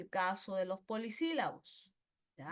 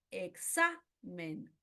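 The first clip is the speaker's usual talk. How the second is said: "Examen" slowly, e-XA... men; stress on penultimate syllable; 3 semitones lower, much slower than usual. Shown as a demonstration. Teacher giving a grave example, slow "Examen" stressed on XA. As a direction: hold the XA of "Examen", then drop to men. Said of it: "en la penúltima".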